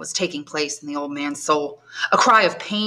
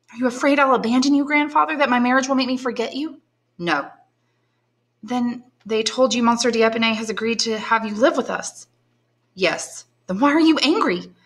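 put-on higher, anxious questioning girl; young woman pleading, raised pitch; young granddaughter; 5 semitones higher than usual. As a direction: coax answers from a mute grandfather.

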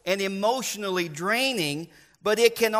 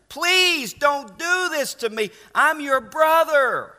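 high, drawn-out plea; acted-out plea for mercy; desperate pleading; 6 semitones higher, 5 dB louder, slower than usual. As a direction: beg them slowly, voice raised in pitch. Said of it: "he was pleading with them".

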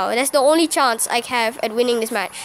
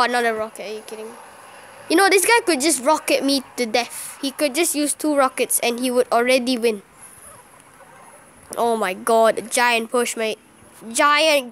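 ordinary talk, nothing marked

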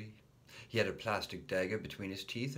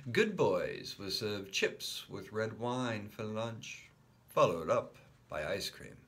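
ordinary delivery, nothing marked

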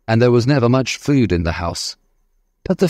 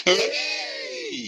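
high excited cheer; children cheering with joy; cheering hooray; 8 dB quieter than usual. A narrator's reading. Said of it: "everybody cried".

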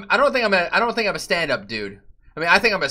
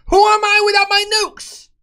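very high, louder mock wail; mock anguished squeal; 5 dB louder than usual. Mock despair high cry.

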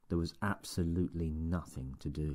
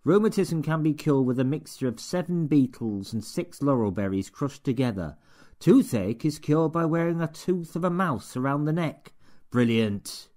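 higher, louder sneering read-aloud; mock every word of the book as you read it; a boss ridiculing his assistant's book, stilted and mocking; 8 semitones higher, 8 dB louder than usual. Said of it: "began to read in a stilted, mocking voice".

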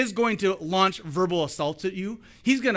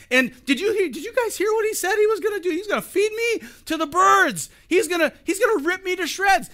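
fast, much higher, panicky inner voice; panicked David; 11 semitones higher, 4 dB louder than usual. Pretend. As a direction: gabble it like a frightened boy. David's scared inner thoughts.

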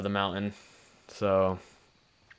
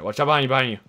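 higher, louder alarmed callout; urgent warning shout; 5 semitones higher, 9 dB louder, much faster than usual. Real, not acted. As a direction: yell the warning fast. Warning teammate of enemy.

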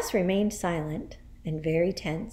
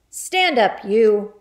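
shouted; 2 semitones higher, 8 dB louder, slower than usual. Acted. Headmistress orders a boy to stand, shouting. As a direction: shout him to his feet. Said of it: "she shouted at him".

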